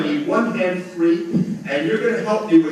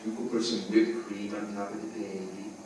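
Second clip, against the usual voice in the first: normal talking voice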